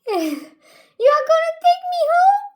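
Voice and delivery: cat voice, delighted. Acted. Cat waking up, asking to be taken home, high giggling voice. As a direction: play the just-woken cat, thrilled and laughing. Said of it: "the cat wakes up and said".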